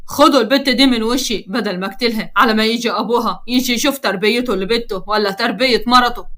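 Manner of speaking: ordinary delivery, nothing marked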